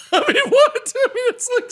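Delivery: high, laughing disbelief. Real, not acted. Cracking up after mocking the mob, laughing. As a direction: laugh through the words in disbelief.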